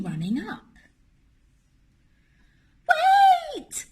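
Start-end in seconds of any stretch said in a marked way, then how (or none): 2.9-3.8 very high, loud shout; urgent cry. A narrator's reading. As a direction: fling it out to stop everyone. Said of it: "she cried".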